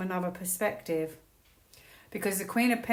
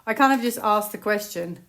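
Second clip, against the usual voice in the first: ordinary talk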